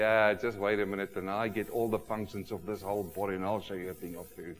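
low, quiet plotting voice, put on; scheming baby. Preacher voices baby lying there plotting, low scheming murmur. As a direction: imitate a baby secretly scheming, dropping very soft at the end. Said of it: "they're lying there and say".

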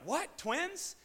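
high-pitched, astonished questions; excited. Retold or imitated. Parents learn of twins at ultrasound, excited. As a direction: squeal it in retold delight. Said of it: "And we're all excited".

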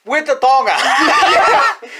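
slow, high laughing punchline. Real, not acted. Host adding the punchline to his friend's story, laughing. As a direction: stretch it out through laughter.

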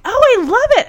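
high, loud gush of mock delight; imitated delight. New driver says she loves it, high loud excited imitation. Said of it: "She's so excited".

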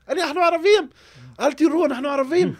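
high, loud frantic yelling; panicked shout. Retold or imitated. Man in a car shouting at unseen gunmen, high and loud in panic. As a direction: scream it to save your skin. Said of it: "אז אני התחילו לצעוק בערבית".

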